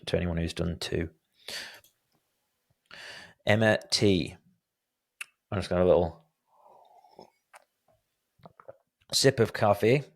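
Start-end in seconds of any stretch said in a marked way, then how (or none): 5.5-10.0 put-on a light American accent slipped in; an American accent, barely put on; offhand American accent. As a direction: mention the coffee in a casual American accent. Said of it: "I said it in an American accent".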